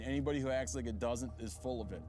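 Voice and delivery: normal talking voice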